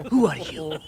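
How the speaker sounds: words broken by laughter; laughing. Acted. Bandit laughs while mocking the Count. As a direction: talk through the tail of your laugh.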